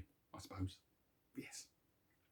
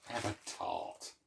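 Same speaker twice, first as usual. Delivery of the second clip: cheeky whisper; 13 dB quieter than usual. Shown as a demonstration. Offering a tart to viewers in a cheeky whisper. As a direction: Have a tart whispered with a saucy lilt. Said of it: "That's a Benny Hill sort of thing".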